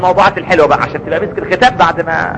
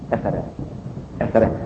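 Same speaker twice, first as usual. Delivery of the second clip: very soft, low sigh of wonder; a nearly whispered marvel; hushed awe; 9 semitones lower, 12 dB quieter, much faster than usual. Real, not acted. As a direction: breathe it out in quiet admiration. Sighing in awe.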